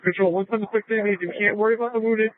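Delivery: usual speaking voice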